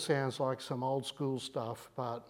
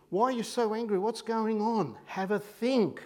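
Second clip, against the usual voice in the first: God questioning; 5 semitones higher than usual. Pretend.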